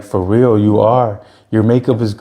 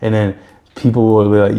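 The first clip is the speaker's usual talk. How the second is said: ordinary talk, nothing marked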